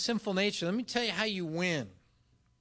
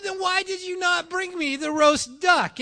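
higher and louder, rising frustration; exasperated demand; 9 semitones higher, 8 dB louder than usual. Retold or imitated. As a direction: demand an answer, fed up. Arguing with waiter over bill.

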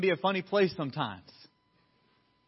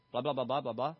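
low, softer droning blahs; tuned-out blah-blah; 3 semitones lower, 4 dB quieter, faster than usual. Retold or imitated. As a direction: mimic words washing past unheard. Tuned-out words heard as blah.

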